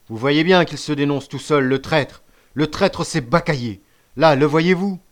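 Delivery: name the traitor for all to hear; triumphant denunciation. A narrator's reading. Chief denouncing Bacayé to the band, loud accusation.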